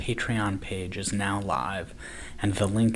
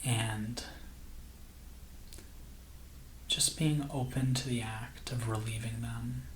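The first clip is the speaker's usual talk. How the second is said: soft, slow meditation voice with long pauses; guided meditation; 7 dB quieter than usual. Acted. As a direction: gently guide listeners into calm, unhurried and hushed.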